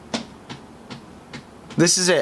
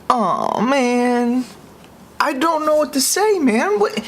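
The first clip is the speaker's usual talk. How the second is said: distraught; 7 semitones higher, slower than usual. Real, not acted. Despair at disappointing update.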